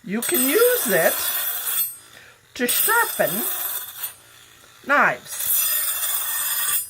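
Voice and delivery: high, dramatic pitchman voice, slowed down; mock infomercial. Hamming up a knife sharpener pitch to viewers in a mock infomercial voice. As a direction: sell it like a cheesy TV ad. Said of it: "This has been an ad".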